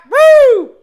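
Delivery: loud high rowdy whoop. Acted. Friends riffing on a bit, loud high whoop. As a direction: whoop like a hollering fan.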